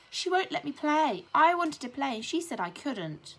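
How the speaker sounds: high, loud tattling whine; whiny complaint. A narrator's reading. Bully telling tales to the teacher, whining loudly. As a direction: sell the fake hurt to sir. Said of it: "wailed Brendan the bully".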